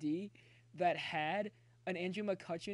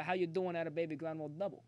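tongue twister fast; faster than usual. Shown as a demonstration. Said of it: "Say that ten times fast".

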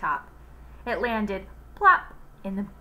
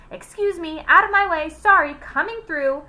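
much higher, louder calls; pushing through; 9 semitones higher, 10 dB louder than usual. Acted. Joy forcing her way through the kids, calling loudly. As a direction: squeeze past, calling out.

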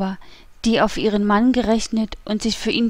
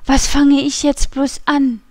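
higher, louder, helpless wail; desperate question; 5 semitones higher, 5 dB louder than usual. A narrator's reading. Wife left alone not knowing what to do, desperate.

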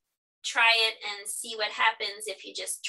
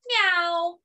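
higher, louder meow; dog meowing; 7 semitones higher, 6 dB louder than usual. Acted. Dog meows in the barn, playful loud meow. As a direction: mew it like a cat. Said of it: "and the dog said".

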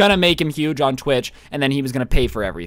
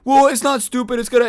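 put-on defensive boyfriend; 9 semitones higher, 4 dB louder than usual. Boyfriend defending himself to girlfriend.

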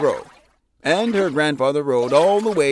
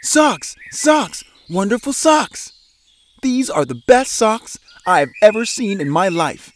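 Tina yelling; 6 semitones higher than usual. A narrator's reading. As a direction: shout the news to the whole house.

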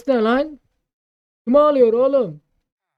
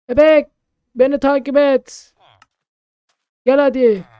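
louder coaxing call; 6 semitones higher, 11 dB louder than usual. Real, not acted. Player calling the baby to follow home, louder.

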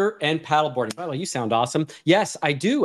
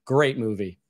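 normal talking voice

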